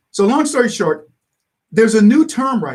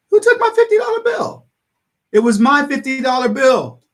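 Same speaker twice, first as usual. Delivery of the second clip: high, raised-voice mock accusation; imitating an indignant accuser; acted outraged demand; 7 semitones higher, 4 dB louder than usual. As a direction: cry out as the wronged owner. Voicing Trump accusing the thieves.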